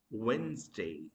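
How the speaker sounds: Wednesday as "WENZ-day"; correct pronunciation. Shown as a demonstration. Teacher naming the next word, Wednesday as "WENZ-day". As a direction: leave the first d silent in Wednesday. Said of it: "the correct pronunciation".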